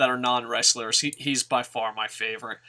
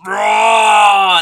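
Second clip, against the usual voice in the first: huge bellowed Raw; a big shouted Raw; mock wrestler roar; 8 semitones higher, 14 dB louder than usual. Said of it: "and then he goes".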